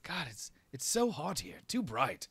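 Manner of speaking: a hint of a pampered prince griping; young prince complaining. Prince drinking water in the heat, lightly whiny.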